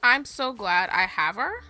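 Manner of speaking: ordinary talk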